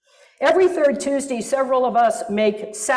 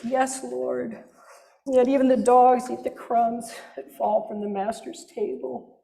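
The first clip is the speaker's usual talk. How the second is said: groveling, begging. Pretend. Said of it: "that sense of groveling and begging".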